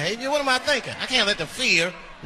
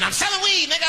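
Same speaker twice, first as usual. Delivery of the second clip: much higher, louder shout; baby dealer voice; 11 semitones higher, 3 dB louder than usual. Acted. The baby answering the driver, high loud shout. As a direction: yell it up high as the street kid.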